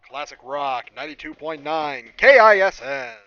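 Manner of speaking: loud, slow, punchy station ID; booming radio jingle voice; radio jingle announcer. Acted. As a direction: sell the station name like a radio promo.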